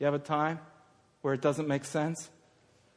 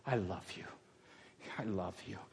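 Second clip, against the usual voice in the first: very soft, low devotion; tender; 9 semitones lower, 16 dB quieter than usual. Acted. Peter saying to Jesus I love you, tender. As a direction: say it low and hushed, heartfelt.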